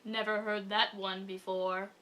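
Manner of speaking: dry and slow, no expression; unimpressed, flat delivery; deadpan. Retold or imitated. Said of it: "in his perfect deadpan voice".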